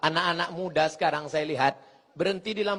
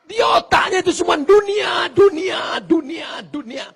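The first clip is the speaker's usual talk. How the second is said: much higher, loud repeated cry fading at the end; preaching shout; 11 semitones higher, 9 dB louder than usual. Acted. Preacher rails at the congregation about worldliness. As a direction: hammer the word dunia until it fades.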